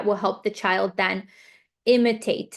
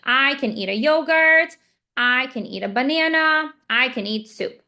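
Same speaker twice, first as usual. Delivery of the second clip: higher, louder options listed one by one; offering options; 4 semitones higher, 3 dB louder than usual. Shown as a demonstration. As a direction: lay out each choice clearly. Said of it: "give an option like".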